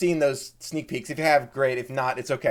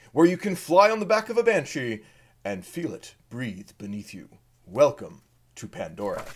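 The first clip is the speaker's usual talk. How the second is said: movie trailer announcer. Acted. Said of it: "that's my announcer voice".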